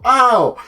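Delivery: loud, high cartoon "ow"; mock cry of pain. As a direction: yelp as if just bumped by a car. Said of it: "he just hears someone go".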